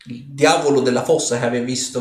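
ordinary talk

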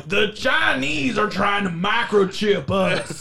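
slow high Southern drawl; Southern conspiracy theorist. Acted. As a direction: paranoid Southern rant. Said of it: "conspiracy theorist voice is a Southern accent".

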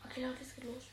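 hushed whisper before the call. Real, not acted. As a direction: whisper so the phone can't hear. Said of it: "Alle ganz leise". (flüsternd)

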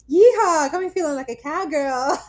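high, loud yeehaw of a robotic cowgirl character; Pinky Doll. Retold or imitated. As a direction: put on a perky livestream cowgirl, breaking into a laugh. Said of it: "acts as if she's, like, anim-, like a robot".

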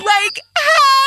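high crying wail; mock-sob the question; imitating sobbing child. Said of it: "And he is sobbing".

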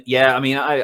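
normal talking voice

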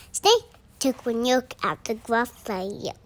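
plain reading voice

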